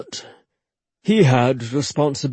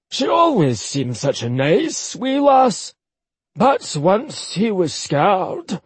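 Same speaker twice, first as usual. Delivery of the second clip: put-on higher, chatty old Scotsman; old Highland man; 7 semitones higher, slower than usual. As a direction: reassure her, gossipy Highland uncle. Akir disapproves.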